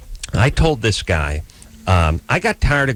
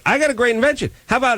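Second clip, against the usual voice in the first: high, eager inventor's pitch; 11 semitones higher, faster than usual. Retold or imitated. Patient pitching his idea to the dentist, high and eager. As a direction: sell the idea brightly. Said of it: "I would say".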